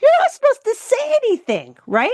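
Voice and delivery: loud, high scolding; high exasperated shout. Retold or imitated. Woman scolding her boyfriend at a wedding, loud high yell. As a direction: yell it in disbelief. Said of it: "He said".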